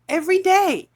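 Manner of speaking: real frustrated outburst; furious. Real, not acted. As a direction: hammer it home in rage. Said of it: "I'm furious".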